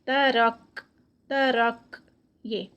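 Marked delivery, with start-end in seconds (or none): 0.0-0.8 ترک ending in a kaf with breath flowing on; breathy kaf. Shown as a demonstration. Teacher showing hams on the kaf. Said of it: "تو اس کو ہمس بولتے ہیں".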